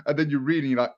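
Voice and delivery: ordinary talk, nothing marked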